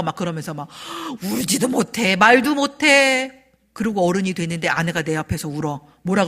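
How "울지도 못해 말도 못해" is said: drawn-out, louder wail of complaint; hammed-up stifled misery; mock lament. On behalf of silenced sons, a drawn-out lament.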